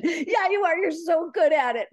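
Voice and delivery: very high, giggly gushing; excited. Retold or imitated. Person flattering her to get help, all excited. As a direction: gush with laughing enthusiasm. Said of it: "Now they're all excited".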